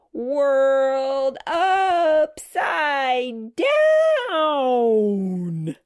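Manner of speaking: high, drawn-out playful yell; upside-down shout. Acted. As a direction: stretch it out like a kid hanging off a fence. Hanging upside down, playful call.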